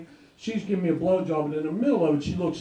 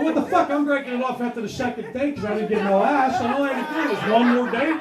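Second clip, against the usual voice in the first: mock outrage; 6 semitones higher, 4 dB louder than usual. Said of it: "I'm going".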